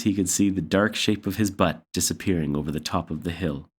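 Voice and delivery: ordinary delivery, nothing marked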